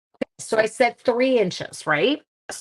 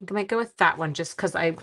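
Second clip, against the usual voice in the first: ordinary talk, nothing marked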